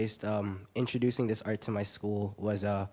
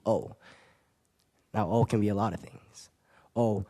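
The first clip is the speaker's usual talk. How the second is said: rhythmic slam delivery, swelling and easing; slam poetry; 4 semitones higher than usual. Acted. Young poet performs his poem about poetry, slam poetry. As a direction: perform it as a driving spoken-word piece.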